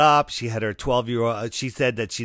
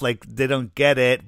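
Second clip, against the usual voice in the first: normal talking voice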